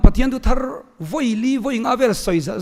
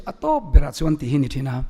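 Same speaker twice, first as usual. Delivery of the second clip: ordinary talk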